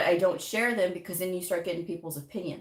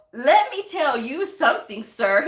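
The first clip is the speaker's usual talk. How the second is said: mock-stern let me tell you something, higher and louder; sassy comeback; 6 semitones higher, 5 dB louder than usual. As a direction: put the rude guy in his place, half-laughing. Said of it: "I go".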